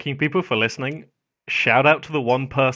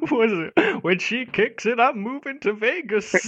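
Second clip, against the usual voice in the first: put-on old man voice; 11 semitones higher than usual. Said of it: "whoever did the old man voice".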